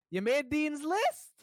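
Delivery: high, loud, slow shocked mom; mom impression. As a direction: play a stunned mother, drawn out. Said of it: "your mom impression is so great".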